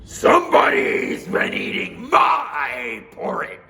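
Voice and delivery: slow, loud, gruff bear; Papa Bear voice. Acted. Papa Bear warning his family, slow and loud. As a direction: growl out the warning slowly.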